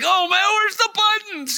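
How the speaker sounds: high, frantic imitation of a flustered man hunting for buttons; mock panic. Preacher imitating a panicked man whose phone rings in church. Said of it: "and then he starts panicking".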